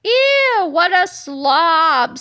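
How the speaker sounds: loudly grossed out; disgusted outburst. Acted. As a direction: recoil in disgust, stretching the words. Melvin reacts to the toenail.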